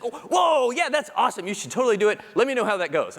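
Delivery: high, bright-eyed approval; a hammed-up boss thrilled by the idea; enthusiastic. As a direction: gush like the news delights her. HR head voiced enthusiastic.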